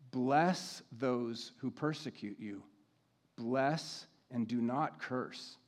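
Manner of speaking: slow, deliberate scripture reading; measured and weighty, with long pauses. Real, not acted. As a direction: read each instruction slowly, pausing to let it land. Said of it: "I'm gonna read this slowly".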